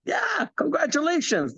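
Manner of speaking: mock upline. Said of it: "And he says".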